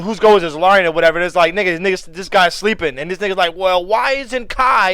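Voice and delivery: ordinary talk, nothing marked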